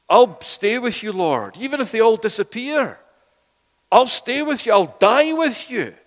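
loud, high, fervent vow of loyalty; boastful vow. Acted. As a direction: swear it out loud like a proud disciple. Peter boasting loyalty to Jesus.

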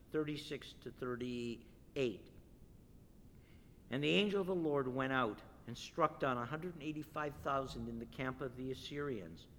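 ordinary talk, nothing marked

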